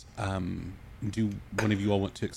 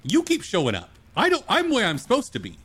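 higher and louder, hurling the blame back; angry accusation; 8 semitones higher, 5 dB louder than usual. Acted. Arancis accusing Eris across the room, angry and loud. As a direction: throw the accusation right back at her.

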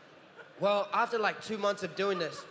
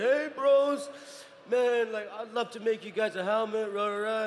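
a touch of a tipsy bro, louder; hint of a drunk bro voice; mock drunk fan; 3 semitones higher, 4 dB louder than usual. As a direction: lightly play an excited tipsy fan. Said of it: "he was a little drunk".

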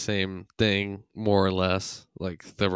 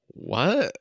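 high, puzzled what; confused what; 8 semitones higher than usual. Retold or imitated. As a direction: sound baffled. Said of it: "the robot was just like".

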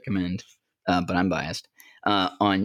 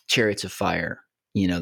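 normal talking voice

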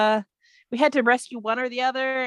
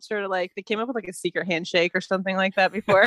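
normal talking voice

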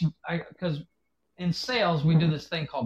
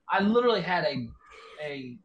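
ordinary talk

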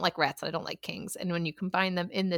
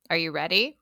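ordinary delivery

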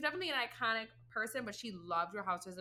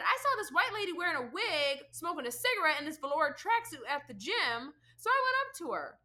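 quoting Nene; 6 semitones higher, 6 dB louder than usual. Retold or imitated. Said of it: "But she said".

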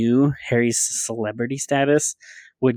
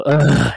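mock groan of exasperation, louder; exasperated groan; 3 semitones higher, 6 dB louder than usual. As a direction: let out a loud, put-on "ugh". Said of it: "so ridiculous that I just go".

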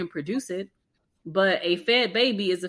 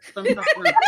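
very high, louder, laughing through it; laughing squeal; 6 dB louder than usual. Retold or imitated. Showing off easy milk flow, laughing high squeal. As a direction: squeak it out giggling. Mimicking effortless milk production.

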